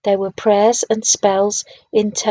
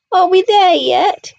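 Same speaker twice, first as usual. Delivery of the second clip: put-on higher, louder, slow whiny child voice; plaintive whine; 9 semitones higher, 7 dB louder, slower than usual. A narrator's reading. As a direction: drag out the complaint like a bored kid. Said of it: "he whined plaintively".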